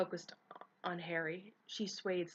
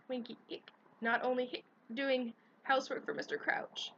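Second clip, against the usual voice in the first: higher indignant elf squeak; 6 semitones higher, 3 dB louder than usual. A narrator's reading. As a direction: squeak back, offended and tipsy. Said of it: "Winky squeaked indignantly".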